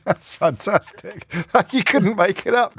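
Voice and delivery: high-pitched, helpless laughing delight. Real, not acted. Host amused by an Australian caller coming on next, laughing. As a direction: crack up while talking, pitch climbing.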